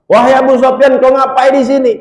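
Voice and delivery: high, loud angry challenge; angry daughter. Acted. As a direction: confront the intruder sharply. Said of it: "Romlah marah". (putri marah membentak ayahnya)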